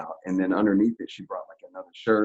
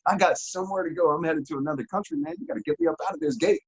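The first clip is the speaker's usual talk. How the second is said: high, fast, pushy pleading; eager urging; 10 semitones higher, faster than usual. Retold or imitated. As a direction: hurry them up, bursting to leave. Urging guards to let him out.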